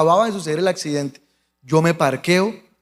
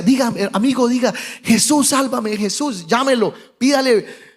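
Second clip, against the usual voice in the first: higher, insistent re-enacted pleading; urgent exhortation; 5 semitones higher than usual. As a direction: kneel over him and urge him on. Exhorta al herido de rodillas. (ruego urgente al herido)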